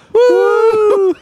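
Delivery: high laughing mock whoop; shrieking woo. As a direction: squeal a woo through laughter. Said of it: "throw one of those in".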